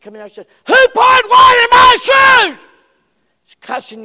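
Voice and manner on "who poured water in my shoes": high furious yell; angry shout. Retold or imitated. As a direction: bellow it like a cursing son. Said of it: "ranting and raving and cussing".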